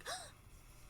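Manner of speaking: sharp dramatic gasp; mock gasp. Finishing her big water bottle, a hammed-up gasp. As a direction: act shocked that the bottle is already empty. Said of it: "I'm like".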